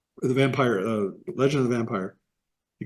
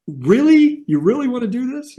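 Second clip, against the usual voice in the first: much higher and louder, can't believe it; stunned disbelief; 11 semitones higher, 5 dB louder than usual. Retold or imitated. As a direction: pitch shoots up in shock at the news. Disbelief as the host announces retirement.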